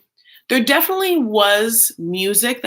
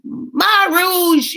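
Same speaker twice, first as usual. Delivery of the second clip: much higher mock sister; sister's voice; 10 semitones higher, 4 dB louder than usual. Sister pushing rouge into her cheeks, high squeal. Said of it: "she's like".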